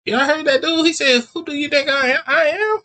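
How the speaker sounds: high, mocking retelling; mock hype. Friend mocking the bowler's mixed-up words, high. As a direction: repeat it up high, amazed and teasing. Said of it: "I'm like".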